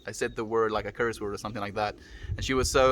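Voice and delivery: usual speaking voice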